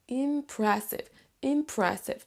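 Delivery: im-PRESS-ive as a teaching model; stress on second syllable. Shown as a demonstration. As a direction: hit PRESS in IMPRESSIVE, falling after. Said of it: "la syllabe numéro deux".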